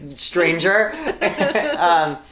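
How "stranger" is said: loud mock shooing away. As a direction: chase off a stranger. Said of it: "all there was to do was be like".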